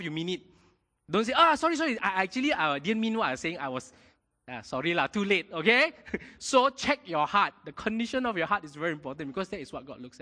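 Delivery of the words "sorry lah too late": ordinary talk, nothing marked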